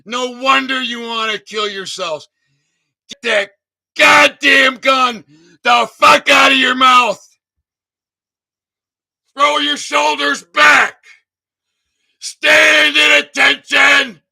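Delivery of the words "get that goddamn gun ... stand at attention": a real order, loud and tearful; commanding shout. Real, not acted. Ordering veterans to stand at attention, tearful commanding shout.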